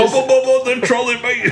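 high, hammed-up British lad; mock British accent. Riffing on Harry Potter for friends, mock British accent. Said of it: "don't they all have British accents".

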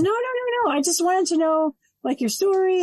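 high, insistent mock reassurance; retold organizer's reassurance. Organizer reassuring the anxious speaker.

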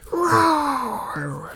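high, drawn-out mock roar; imitated monster roar; dinosaur roar.

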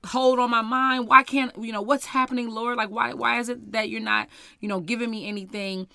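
worked-up, pleading protest; frustrated pleading. Retold or imitated. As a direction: act out exasperated questioning in prayer. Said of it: "I'm getting frustrated".

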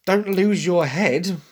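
slow, sassy back-talk; mild mock teen back-talk. Shown as a demonstration. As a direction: throw it back like a teen at a parent. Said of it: "teenager sort of talking back to their parents".

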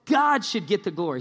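loud, pious proclamation; acting all spiritual. Pharisees address the healed man, acting all spiritual. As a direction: declare it with showy piety. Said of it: "they're acting all spiritual".

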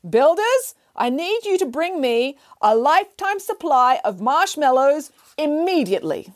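high, slow call down from a tree. Acted. Nanny Piggins calling down to builders, high and slow. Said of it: "called down".

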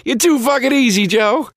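high, gloating reveal that it was a wind-up; gleeful taunt. Retold or imitated. Patrice in the bathroom telling Joe he was only baiting him, in a high gloating taunt. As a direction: rub it in that he fell for it. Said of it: "he goes".

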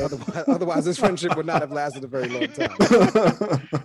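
laughing hard while teasing a friend. Real, not acted. Joking about ending the friendship.

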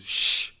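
sharp, loud imitated shush. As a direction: shush like a woman hushing a crowd. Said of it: "this woman goes".

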